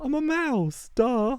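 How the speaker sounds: high mocking valley-girl duh; snotty girl voice. Mouse girl answering him, very high and snotty. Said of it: "She was like".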